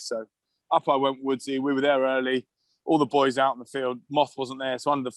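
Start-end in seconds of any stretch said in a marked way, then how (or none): none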